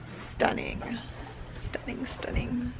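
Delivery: repeated breathy whisper; whispered awe. Real, not acted. Vlogger to viewers, whispering in wonder at the lake. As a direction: whisper it over and over, lost in the view. Gazing at the view.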